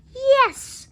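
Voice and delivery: high bright cry of yes; cried. A narrator's reading. Witch eagerly welcoming the frog, cried yes. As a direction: call it out gladly. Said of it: "cried the witch".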